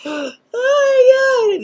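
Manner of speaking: high-pitched kid's wail, hammed up; exaggerated gasping boo-hoo; mock crying. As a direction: fake a little kid bawling their eyes out. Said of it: "they're crying their eyes out".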